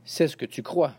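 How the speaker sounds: ordinary delivery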